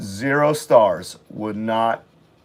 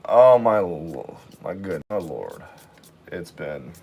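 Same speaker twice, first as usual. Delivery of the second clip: usual speaking voice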